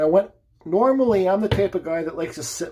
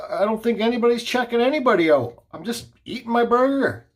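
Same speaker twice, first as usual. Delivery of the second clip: exasperated protest; 6 semitones higher than usual. Retold or imitated. Acts out exasperated plea in quarrel.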